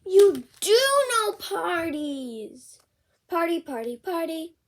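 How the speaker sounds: lively picture-book read-aloud; bouncy storybook reading; reading to children. Acted. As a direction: read it out with big character voices for a kid.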